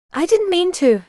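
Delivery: high, plaintive mumble; mumbled, voice cracking. A narrator's reading. Brother excusing himself to his sisters, cracking mumble. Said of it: "voice cracking".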